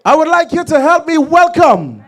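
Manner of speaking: high, projected stage introduction; event announcer. Real, not acted. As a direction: announce the next act to the crowd. Announcer introducing the next act.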